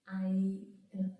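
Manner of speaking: normal talking voice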